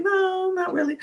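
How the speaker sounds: high, soft, knowing mock answer; a high little aside, fading off; mock reply. Vlogger mocking the answer, high and fading. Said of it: "it was like".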